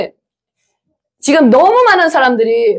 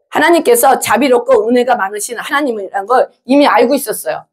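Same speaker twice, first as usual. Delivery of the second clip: ordinary talk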